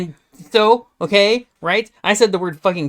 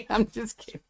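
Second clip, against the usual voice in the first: ordinary talk, nothing marked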